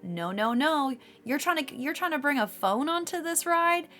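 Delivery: ordinary talk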